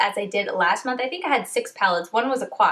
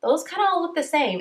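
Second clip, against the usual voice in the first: higher, disappointed realization voice; mock letdown; 8 semitones higher than usual. Reviewer voicing herself spotting repeated shades, high mock letdown. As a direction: play the flat letdown of seeing every look come out the same. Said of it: "it's like".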